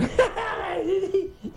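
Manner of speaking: risadinha alta e solta; risada rápida. Retold or imitated. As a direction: ri como quem vê piada no celular. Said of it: "e dando risada". (risadinha de quem não se concentra)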